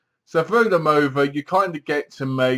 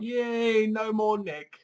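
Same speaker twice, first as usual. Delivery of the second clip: high, gleeful yay; a hammed-up little cheer; mock cheer; 8 semitones higher, 5 dB quieter, slower than usual. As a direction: cheer sarcastically at his exit. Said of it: "you're like".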